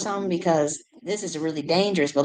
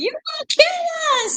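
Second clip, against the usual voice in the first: mock squeal, much higher and louder; imitating the girl; 3 dB louder than usual. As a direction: squeak it like a panicked little girl. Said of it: "She's saying".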